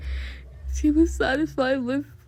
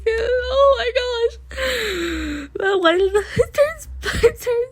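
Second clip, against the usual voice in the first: high excited squeal of words; flustered; 9 semitones higher, 4 dB louder than usual. Real, not acted. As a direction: gush out, overwhelmed. Flustered outburst.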